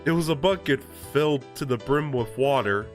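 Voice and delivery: plain reading voice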